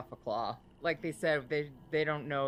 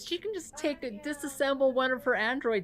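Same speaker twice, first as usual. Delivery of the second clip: laughing; 9 semitones higher, 5 dB louder than usual. Real, not acted. Laughing joke about android.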